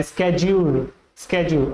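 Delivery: स्केड्यूल with a hard sk start; sked-yule. Shown as a demonstration. Teacher showing the American स्केड्यूल. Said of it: "स्केड्यूल बोला जाता है".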